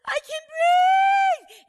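screamed very high and loud, drawn out slow; joyful shout. Retold or imitated. Healed lady shouting to the church, ecstatic slow scream. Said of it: "And she shouted".

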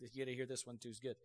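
normal talking voice

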